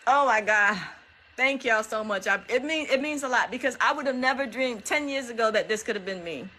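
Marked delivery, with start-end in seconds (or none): none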